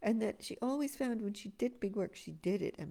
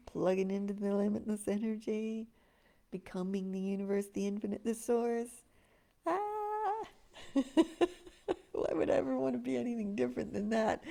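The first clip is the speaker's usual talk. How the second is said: rising up high and loud with delighted laughs; high energy; 2 semitones higher, 4 dB louder than usual. Shown as a demonstration. Teacher demonstrating her high vibration, ecstatic and laughing. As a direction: burst into bright ecstatic energy. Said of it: "You could see the difference".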